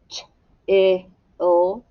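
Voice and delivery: Ch-I-L as three separate sounds with gaps; sounded out. Shown as a demonstration. Teacher tapping it out for the class, Ch-I-L sound by sound. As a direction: tap out each sound of Ch-I-L. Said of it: "tap it out".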